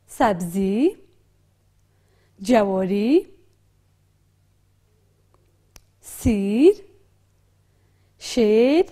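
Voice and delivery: each word said clearly with a pause; naming pictures aloud. Shown as a demonstration. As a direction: read the list slowly for learners. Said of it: "کلمه اول چی است؟". (خواندن آهسته و واضح کلمات)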